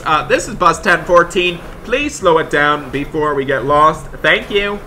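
loud dispatch call; loud radio request. Pretend. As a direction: speak into the bus radio to the other driver. Radio call to other driver.

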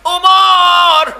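high, loud, drawn-out plaintive plea; tearful cry. Acted. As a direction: wail it out in acted grief, stretching the names. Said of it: "চোখের পানি ছেড়ে দিয়ে বলে". (আবেগে কাঁদো কাঁদো উঁচু গলায় আর্তি)

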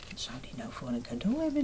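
put-on fast whisper straight to the child; whispered aside. A narrator's reading. Storyteller whispering a question to the child, fast.

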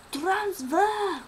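high, loud game-show call; excited announcing. Acted. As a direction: announce it like a big reveal.

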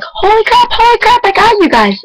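very high, excited shriek of relief; overjoyed squeal. Retold or imitated. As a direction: squeal like you finally got through. Reaching the radio station at last.

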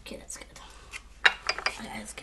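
whisper. Real, not acted. Whispering while eating.

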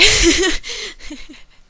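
loud high laugh after dying; big surprised laugh; burst of laughter. Real, not acted.

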